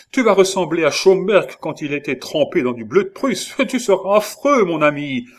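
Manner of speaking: alarmed pleading. A narrator's reading. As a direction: remonstrate loudly with a dear friend. King pleads with his frantic favourite. (remontrance implorante, voix haute)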